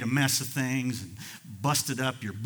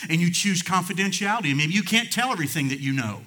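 loud, raised pulpit voice; fervent preaching; 7 semitones higher, 8 dB louder than usual. Acted. Preacher urging the congregation to keep confidences, fervent. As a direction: drive the point home at full force. Preacher builds to full force.

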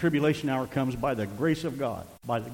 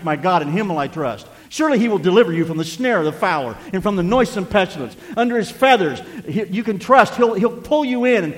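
raised, higher, impassioned preacher voice; full-force preaching; 5 semitones higher, 9 dB louder than usual. Real, not acted. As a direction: drive the truth home loudly from the pulpit. Preaches and recites psalm at full force.

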